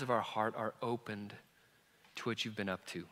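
soft, quiet, earnest prayer; closing prayer. Acted. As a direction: pray gently over the church.

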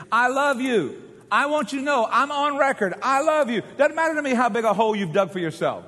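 God declaring love. Acted. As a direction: proclaim it insistently, pitched high. Preacher voicing God's declaration of love.

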